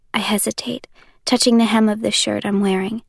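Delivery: ordinary talk, nothing marked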